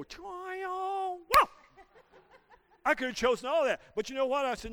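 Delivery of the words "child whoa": mock outburst. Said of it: "wanna go over there and go".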